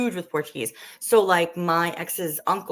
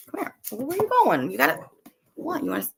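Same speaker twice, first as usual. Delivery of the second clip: pet talk; 12 semitones higher, faster than usual. Real, not acted. Talking to her pets.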